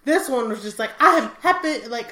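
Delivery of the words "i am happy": imitating an African accent, high and loud; a higher, louder happy quote in a put-on African accent; playful African-accent impression of a happy man; mock African accent. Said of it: "I'm Selassie".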